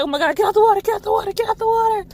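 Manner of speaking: high, urgent repeated pleading; frantic warning. Retold or imitated. Friends begging swimmers to leave the water, frantic and high. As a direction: hurry them out of the water.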